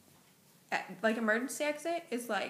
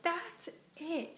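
higher-pitched retold outburst; mock exasperation; 6 semitones higher than usual. Gallery owner snapping at visitors who touched things, mock fed-up. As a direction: imitate someone whose patience just ran out.

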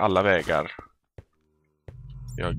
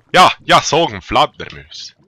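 put-on Norrland accent; 3 semitones higher, 10 dB louder than usual. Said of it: "riktigt norrländsk".

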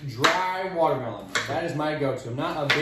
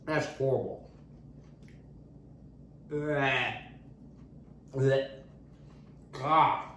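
disgusted by taste; 6 dB quieter, slower than usual. Real, not acted. First bite of bad melon.

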